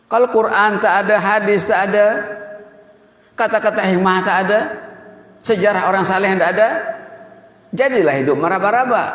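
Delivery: high-pitched, insistent warning in a rising list; emphatic preaching. Real, not acted. Penceramah memperingatkan jemaah dengan nada naik. (ceramah berapi-api, nada tinggi)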